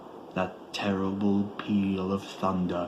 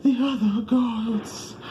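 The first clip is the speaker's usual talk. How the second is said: high, loud cry of horror; terrified scream; 5 dB louder than usual. A narrator's reading. Barzai sees the other gods, a high terrified scream. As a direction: scream it in pure terror. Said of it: "such a cry as no man else ever heard".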